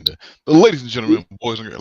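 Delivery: big booming ring announcer intro; ringmaster. Retold or imitated. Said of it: "you gotta go back and add, uh, the introduction".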